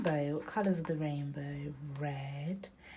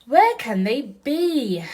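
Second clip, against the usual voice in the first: sighing duck; 6 semitones higher, 13 dB louder, slower than usual. Acted. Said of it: "he sighs".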